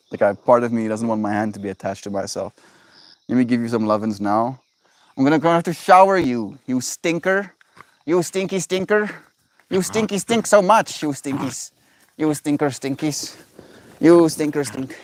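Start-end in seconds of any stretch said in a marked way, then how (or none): 5.2-15.0 high, fast pet-talk teasing; playful squeaky scolding of a pet. Real, not acted. Baby talk to the dog.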